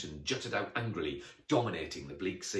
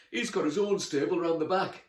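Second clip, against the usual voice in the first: grim; 9 semitones higher, 6 dB louder than usual. Acted. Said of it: "said grimly".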